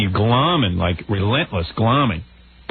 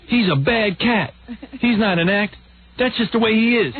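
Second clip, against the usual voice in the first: high, hammed-up denunciation; Soupy impression; 10 semitones higher than usual. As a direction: pronounce judgment in a put-on high voice. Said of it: "I like this part".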